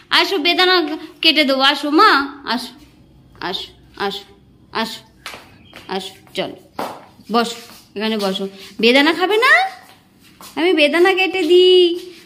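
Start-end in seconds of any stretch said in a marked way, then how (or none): 2.4-8.5 much softer, lower coaxing; almost under the breath, urging her to come; hushed pleading to come sit. Real, not acted. Quiet coaxing.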